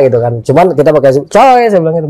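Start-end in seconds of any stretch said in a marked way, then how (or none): 1.2-1.6 high-pitched hail; called-out signal. Retold or imitated. Showing the team's danger code, called out high. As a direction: call it out like a code.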